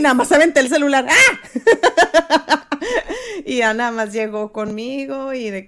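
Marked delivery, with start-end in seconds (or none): 1.1-2.6 high, loud retold yelp; a hammed-up gasp of panic; mock startled cry. Mock yelp of panic as she tosses her phone to the side. Retells panicking when pulled over.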